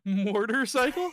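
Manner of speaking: high, loud, slow incredulous echo; amused disbelief. Real, not acted. Guest echoing the host's odd word, amused and incredulous. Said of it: "laughing echo of odd word".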